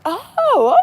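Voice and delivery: high, surprised little oh; a sudden high realization; mock surprise. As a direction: caught off guard about the holiday, a high oh. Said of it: "I'm like".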